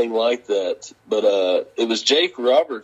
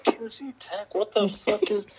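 high, softer puzzled repeat; mock bafflement at the wrong name; confused disbelief; 10 semitones higher, 3 dB quieter than usual. Hearing the crowd mangle his name, softer baffled repeat. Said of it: "And I was like".

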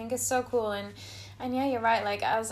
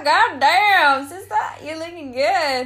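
much higher, much louder, hammed-up hype voice; mirror self-hype; 6 semitones higher, 14 dB louder, slower than usual. Hyping up her own reflection, loud and high. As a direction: gush at your own reflection.

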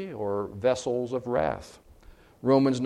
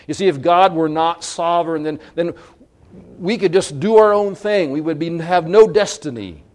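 louder and higher, pressing the point; preacher raising his voice to warn; rising preaching; 4 semitones higher, 7 dB louder than usual. Real, not acted. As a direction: build it up with force.

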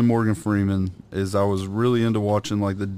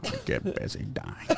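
much lower quiet voice, dropping to a breath; Morgan Freeman impression; 8 semitones lower, 9 dB quieter than usual. Asked to do Morgan Freeman, low and quiet. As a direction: do a self-doubting Morgan Freeman. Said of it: "I'll do it".

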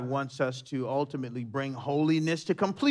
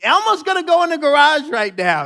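high, loud firm call; mock commanding wife; wife taking charge; 11 semitones higher, 10 dB louder than usual. Wife sending Elmo back to the garage, loud and firm.

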